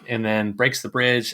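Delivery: ordinary delivery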